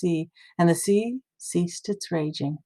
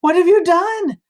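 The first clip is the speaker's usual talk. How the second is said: high, loud horrified cry; terrified cry; 10 semitones higher, 7 dB louder than usual. A narrator's reading. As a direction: cry out in terror. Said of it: "the men were terrified".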